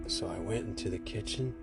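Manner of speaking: plain reading voice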